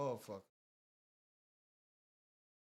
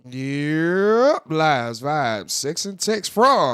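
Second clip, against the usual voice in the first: booming announcer roll call; loud hype intro; 3 semitones higher, 17 dB louder, much slower than usual. Acted. Host announcing lives, vibes, sex and text, booming. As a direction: belt out the segment title.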